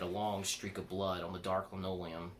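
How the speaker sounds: ordinary delivery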